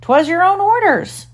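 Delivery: very high pirate protest; protesting deckhand. A narrator's reading. Deckhand protests to angry captain.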